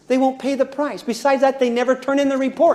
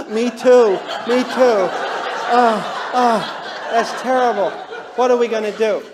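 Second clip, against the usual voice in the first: anxious worried couple. Retold or imitated. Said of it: "two blues married".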